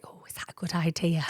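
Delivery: unvoiced worried whisper; whispered doubt. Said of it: "And she's like".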